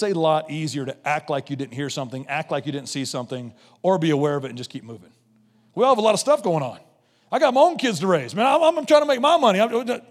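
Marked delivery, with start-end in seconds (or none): 5.7-10.0 high, hurried mock protest; raised, flustered pleading, put on; mock excuse-making. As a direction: imitate someone rattling off excuses. Voicing people's excuses for not helping.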